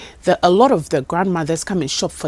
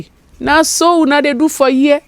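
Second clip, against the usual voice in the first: much higher and louder, worked up; worked-up telling; 7 semitones higher, 5 dB louder than usual. Real, not acted. Worker telling how she answered the flirt, loud and high. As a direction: tell it all fired up. Heated retelling of reply.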